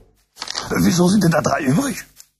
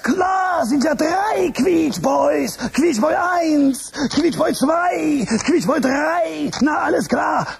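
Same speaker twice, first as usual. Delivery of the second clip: excited counting; 6 semitones higher, much slower than usual. Acted. Host working out the gummy bear count, slow excited shout. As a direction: spell it out triumphantly, one by one.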